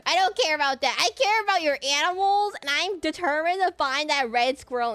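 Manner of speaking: loud, high, emphatic insistence; really mean it; excited rant. Real, not acted. Passionate rant about animals.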